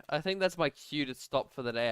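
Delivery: ordinary talk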